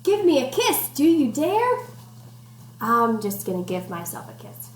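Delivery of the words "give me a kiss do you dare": higher, teasing sing-song; playful rhyme reading. Acted. Teacher reading playful rhyme, higher and teasing. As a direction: tease the kids with the rhyme. Reading picture book to children.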